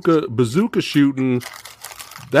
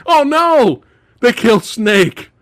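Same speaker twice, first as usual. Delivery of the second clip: high, loud lament; hammed-up cry of horror; mock dismay; 7 semitones higher, 4 dB louder than usual. Fan reacts to Snake being killed, mock dismay.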